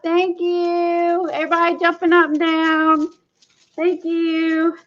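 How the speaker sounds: high, thrilled exclamations of relief; excited. Real, not acted. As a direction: squeal with real joy as it finally works. Foil finally works, audience cheering.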